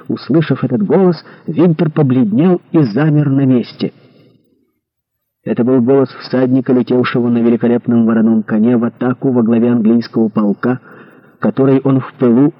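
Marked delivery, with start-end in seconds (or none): none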